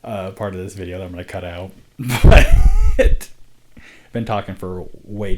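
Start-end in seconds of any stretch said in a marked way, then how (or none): 1.8-2.9 a sudden high squealing laugh. Real, not acted. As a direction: let out a high shriek of laughter. Laughs about embarrassing part.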